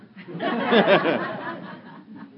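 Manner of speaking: hearty full laugh; loud laugh. Real, not acted. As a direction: burst of loud real laughter. Laughs at her question.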